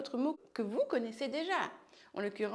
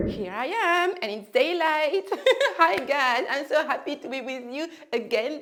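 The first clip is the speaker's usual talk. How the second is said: bright, loud hello to the viewers; excited greeting; 4 semitones higher, 8 dB louder than usual. Real, not acted. Teacher greeting her viewers, excited and loud. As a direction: burst in cheerfully to greet the class.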